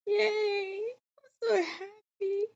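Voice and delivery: high, slow happy crying; real happy tears over the ending; tearful joy. Real, not acted. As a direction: cry with happiness. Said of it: "I'm not gonna get emotional".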